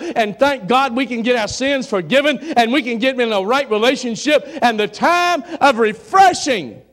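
loud, high, impassioned plea; fervent preaching. Real, not acted. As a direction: cry out to the church in full voice. Preaches at full force.

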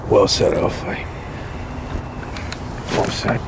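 low, soft, breathy concession; hushed whisper. Acted. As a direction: whisper the respect. Conceding after long pause.

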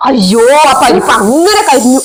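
drawn-out dismay over the burnt pot; slow, dragged-out groan of dismay; slow dismayed wail. Acted. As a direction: lament the ruined dal, slowly.

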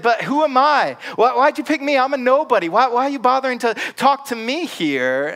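a reluctant nobody begging off; whining Moses. Acted.

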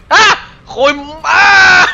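high, loud startled screams and a curse; shriek, curse, shriek; screaming. Real, not acted. Player scared mid-game, screaming in real fright. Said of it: "voy a gritar mucho".

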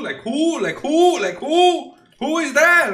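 high, loud, incredulous yelling; playful fake outrage at the card; mock outraged shout. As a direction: demand to know who this guy is, loudly. Mocking another unknown player.

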